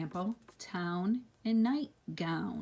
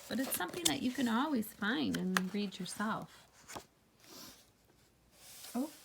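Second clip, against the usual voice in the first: ordinary delivery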